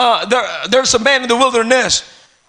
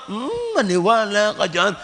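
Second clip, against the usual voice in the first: sceptical listener; 3 semitones lower, 6 dB quieter than usual. Acted. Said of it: "Akala mo parang".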